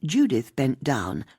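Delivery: ordinary delivery, nothing marked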